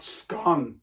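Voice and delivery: "scone" with a short, open O; scone rhyming with "gone". Shown as a demonstration. Teacher showing the rejected way, "scone" with short O. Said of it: "not scone".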